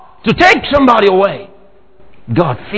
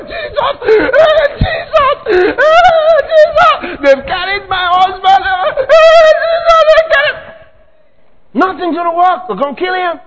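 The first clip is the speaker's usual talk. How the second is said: acted crying wife; 12 semitones higher than usual. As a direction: cry out Jesus, husband dragged off. Acted wife crying over kidnapping.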